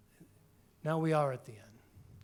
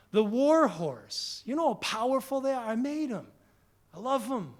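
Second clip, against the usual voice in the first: proud Creator; 5 semitones higher, 4 dB louder than usual. Acted. God boasting about creation.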